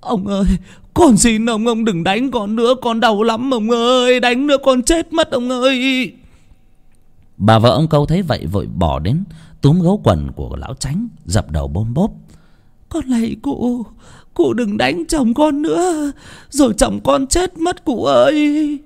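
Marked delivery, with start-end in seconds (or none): 0.0-6.2 put-on high, wailing plea of a beaten old man; pained begging. Beaten old Câu begs Chánh to stop, high pained wail. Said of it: "Ông Câu đau đớn van xin". 12.9-18.8 very high, slow wailing plea; desperate wife pleading. Acted. Câu's wife begs Chánh to spare her husband, high slow wail.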